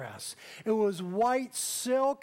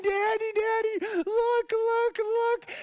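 put-on very high, louder child's squeal; excited little girl; 12 semitones higher, 6 dB louder, slower than usual. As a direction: bounce the words like a thrilled four-year-old. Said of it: "She was so excited".

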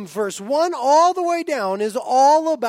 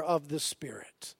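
hushed whisper; 8 semitones lower, 10 dB quieter than usual. Real, not acted. Preacher whispering the point to his congregation. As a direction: drop to a whisper on the last words.

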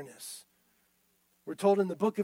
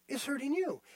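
usual speaking voice

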